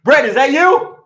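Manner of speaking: high, loud, excited call. Real, not acted. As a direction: call to your son across the house. Calling out to son offstage.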